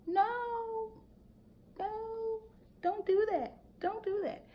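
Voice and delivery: high, soft, drawn-out no no, don't do that; high warning no. Real, not acted. Coaching wives against attacking husbands, high soft warning. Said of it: "warning wives, voice jumps high".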